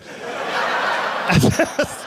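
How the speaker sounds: wheezing laugh at his own joke; breathless laugh. Real, not acted. Comedian cracking up at his own dad joke, wheezy laugh. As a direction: laugh silently at the bit.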